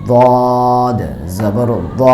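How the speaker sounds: very slow, দ said with a dark, rounded vowel; drawn-out tajweed spelling of দদ জবর দ; দ stretched with a full-mouthed heavy a; heavy দদ recitation. Shown as a demonstration. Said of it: "পরিপূর্ণ আকারের মতো হবে না".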